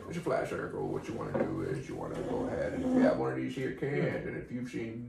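put-on mellow movie-star voice; Matthew McConaughey. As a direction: ramble lazily like a mellow actor. Plays Mister McConaughey.

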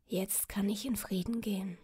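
soft whispered farewell; peaceful dying whisper. Acted. Dying king speaks last words.

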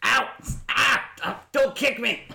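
high, yelled scuffle cries of a scruffy thug; Geck's angry voice. Acted. GM voicing the thief yelling at the pig. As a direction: shout him off like a cornered thief. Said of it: "It was like this".

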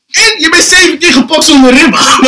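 very high, loud, laughing indictment; incredulous outrage. Acted. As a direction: pile on the charge, half laughing. Listing his friend's offences, outraged. (lachend verontwaardigd)

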